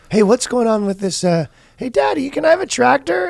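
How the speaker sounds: high-pitched mock child; little kid voice. Son asking Daddy for a tractor, high kid voice. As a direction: put on a small child's high pleading voice.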